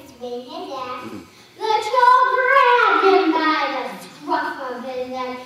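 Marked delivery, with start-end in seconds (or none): 1.6-5.3 booming narration. A narrator's reading. Narrator booming troll scene.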